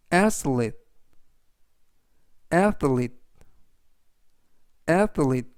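"athlete" with the stress on the opening A, falling after it; teacher modelling "athlete" stressed up front; "athlete": lean on the first syllable, keep "lete" light. Shown as a demonstration. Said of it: "강세는 맨 앞에 있는 A의 강세가 있습니다".

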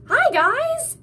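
high, loud happy greeting; relieved cry to his friends; cried out. A narrator's reading. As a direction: Tim calling out to his friends from the lair. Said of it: "Tim cried".

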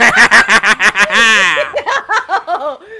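slow, loud shout with laughter; gleeful shout. Acted. Taking revenge in the game, gleeful loud shout. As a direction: gloat it out, laughing.

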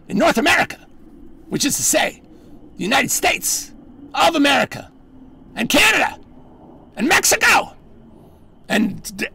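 mock-grand escalating proclamation; building rant. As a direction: pile up the places till you're yelling.

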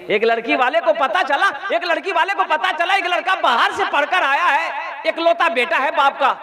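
chuckling through the story; amused storytelling with laughter; laughing. Real, not acted. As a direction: tell it while laughing. Preacher telling comic tale, laughing.

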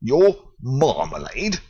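slow, puzzled repeat; can't believe the word; incredulous foreman. Acted. The foreman questions Paddington, slow disbelief.

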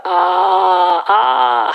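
high, sharp "아" like being pinched; mock ouch. Shown as a demonstration. Teacher showing students a pinched yelp, mock ouch. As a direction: yelp as if someone pinched you. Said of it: "그 느낌을 살려서".

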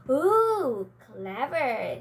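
impressed ooh. Acted. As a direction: sound impressed. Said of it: "said the fairy maidens together".

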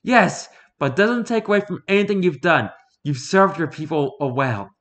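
confident woman hunter, voiced high; Fumiko's voice. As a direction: lift the pitch up for the huntress.

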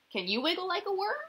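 high, fast, eager ask; playful invitation. Acted. Teacher invites children to wiggle like worms, eager. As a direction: coax them to wiggle.

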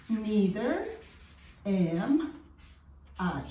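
neither... am... I, each word apart; posh slow Neither am I; elegant, slow and spaced. Shown as a demonstration. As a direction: say it slowly like a refined lady. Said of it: "suena más elegante".

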